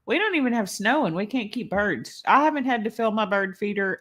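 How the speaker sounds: ordinary delivery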